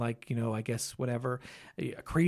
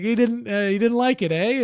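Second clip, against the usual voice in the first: teasing; 6 semitones higher, 5 dB louder than usual. Retold or imitated. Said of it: "I was like".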